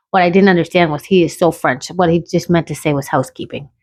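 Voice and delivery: ordinary talk, nothing marked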